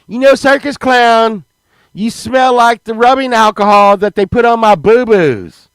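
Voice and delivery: high, loud comic telling-off; indignant kid. Retold or imitated. Four-year-old insulting a circus clown, high loud indignation.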